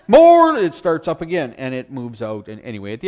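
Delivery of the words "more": much higher, loud "More"; shouted command. Retold or imitated. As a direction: bellow the single word.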